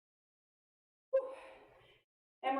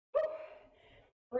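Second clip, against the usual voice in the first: tired whew; 13 semitones higher than usual. Real, not acted. Tired exhale after curls.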